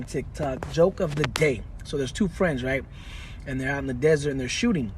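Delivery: ordinary talk, nothing marked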